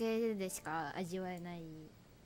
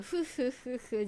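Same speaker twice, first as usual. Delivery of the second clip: imitated chuckle; 3 semitones higher, 4 dB louder, much slower than usual. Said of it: "英語ボイスだと笑い上がるんですよ".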